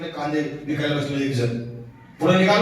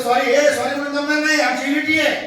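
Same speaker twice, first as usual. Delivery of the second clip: high, raised protest; mock indignant; 7 semitones higher than usual. Gathered animals confront him for hitting their friend, mock indignant. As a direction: push back in a high indignant voice.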